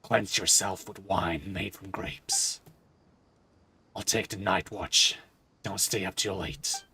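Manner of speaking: slow, low recited verse; poem recitation. Acted. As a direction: read the song as a poem. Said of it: "it's just gonna be a poem".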